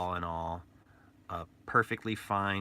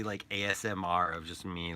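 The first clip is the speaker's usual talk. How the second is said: ordinary talk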